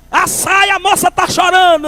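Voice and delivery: high shout, pleading and scolding; shouting doorman, pitched high; retold yell. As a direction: yell that the girl is crying. Doorman yelling about crying girl.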